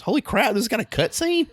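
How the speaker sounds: high-pitched mock amazement; Hammy's astonishment. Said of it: "and Hammy goes".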